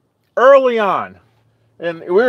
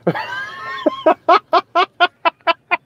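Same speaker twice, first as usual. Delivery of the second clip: long high laugh. Real, not acted. Laughing at own joke.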